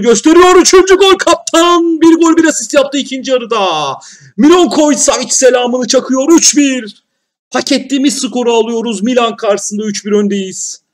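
high, loud jubilant call; shouting with joy at another goal; goal celebration shout. Real, not acted. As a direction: belt out the third goal.

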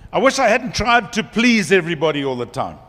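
ordinary talk

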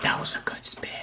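whispered aside. Real, not acted. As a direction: breathe it out unvoiced.

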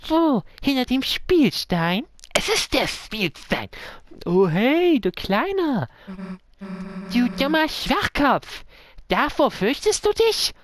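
high, teasing, scornful voice; mocking tough girl. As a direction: sneer at the scared little one. Character taunts the frightened one.

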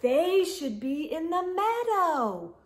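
sing-song the refrain for little ones; storybook refrain. A narrator's reading. Reader asking the children what to do, sing-song.

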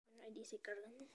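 hushed whisper in the dark. Real, not acted. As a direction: whisper so nothing hears you. Quiet start of horror map.

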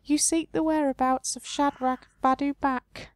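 high, strange otherworldly voice; the tree face naming Shadrach's whereabouts. Acted. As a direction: speak as the ancient tree. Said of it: "keep that voice up now".

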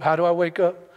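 usual speaking voice

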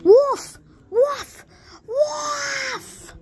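high, drawn-out mock cries; crying wolf. Trapped player calling out wolf, high playful yelling. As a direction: yell it high, stretching the last one.

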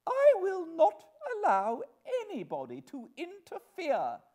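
high, loud outraged grande dame; imperious wife. Acted. Wife refusing interference from her husband, imperious. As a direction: declare it with loud, haughty indignation. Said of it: "said Missus Pocket".

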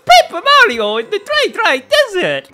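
high, loud cartoon greeting; Mario. Host opens another episode as the game's hero, high cartoon voice. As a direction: kick it off in a bouncy, high-pitched character voice. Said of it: "it's me, Mario".

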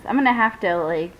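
ordinary delivery

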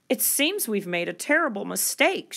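usual speaking voice

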